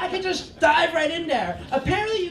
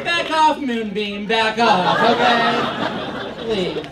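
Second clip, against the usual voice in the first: louder mock warning, laughing; mock back off; 2 semitones lower, 4 dB louder, much slower than usual. Brushing off a preachy yoga girl, loud mock warning. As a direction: shove her off with a laughing bark. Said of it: "I was like".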